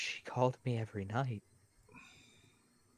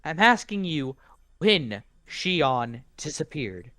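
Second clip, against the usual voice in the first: slow angry insistence; 4 semitones higher, 10 dB louder, slower than usual. Acted. Insisting angrily, slowed.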